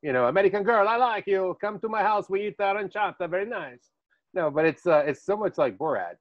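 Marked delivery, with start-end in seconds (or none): 0.3-3.9 high, hammed-up Italian-accented broken English; a lot higher, playful mock Italian accent; Italian kids' accent. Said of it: "he sounded like those Italian kids".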